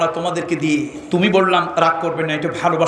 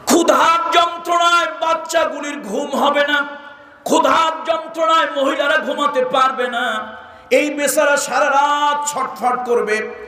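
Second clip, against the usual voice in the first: impassioned preaching; 10 semitones higher than usual. Acted. Preacher describing hungry children to the crowd, impassioned. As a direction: cry out the hardship in a high sermon chant.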